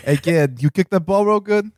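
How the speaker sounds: put-on high, hammy Boston accent. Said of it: "I'm doing a terrible Boston accent".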